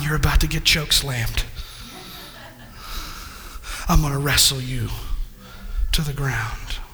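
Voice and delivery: a hint of low, quiet defiance; defiant calm. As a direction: dare the devil, unshaken. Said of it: "when you got the peace".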